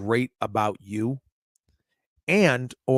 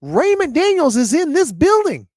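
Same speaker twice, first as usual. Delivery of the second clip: incredulous; 10 semitones higher than usual. Retold or imitated. Retells his own disbelief.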